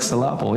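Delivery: syllable with the middle stressed, sil-LAB-ble; joking wrong stress. Shown as a demonstration. Teacher finishing a grammar joke, syllable stressed as sil-LAB-ble. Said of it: "you put the wrong emphasis on it".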